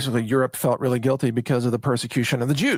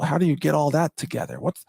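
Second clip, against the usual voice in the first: usual speaking voice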